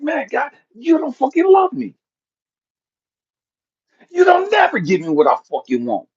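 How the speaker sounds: high, petulant and shouted; whiny complaint to God. Retold or imitated. Man complaining to God, high, whiny and shouting. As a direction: whine at God like a kid.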